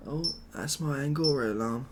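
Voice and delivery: ordinary delivery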